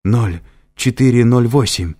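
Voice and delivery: shouted out of breath; breathless shout. A narrator's reading. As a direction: gasp out a loud cry. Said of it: "едва дыша выкрикнула Триш". (едва дыша, выкрикивая)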